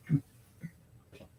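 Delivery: hushed unvoiced come here; whisper. Real, not acted. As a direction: breathe Come here without voice. Repeats it hushed.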